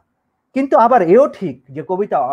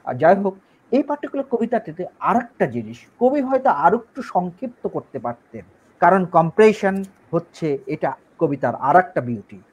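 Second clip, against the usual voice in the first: normal talking voice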